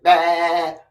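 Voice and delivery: ordinary talk, nothing marked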